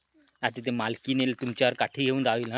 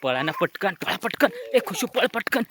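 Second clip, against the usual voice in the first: urgent calling; 7 semitones higher than usual. Pretend. Urging children to flee.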